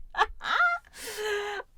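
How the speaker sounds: high, loud burst of real laughter. Real, not acted. Laughs at her own Star Wars muddle.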